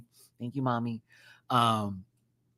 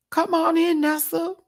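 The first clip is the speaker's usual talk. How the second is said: calling out; 4 dB louder than usual. Real, not acted. Calling someone into the room.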